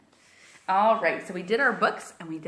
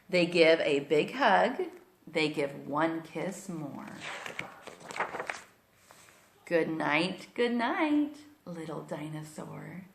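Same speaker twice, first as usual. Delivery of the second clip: put-on storybook read-aloud for little kids; reading to children; 3 semitones higher, 4 dB louder, slower than usual. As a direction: read it like bedtime story time. Reads picture book aloud to kids.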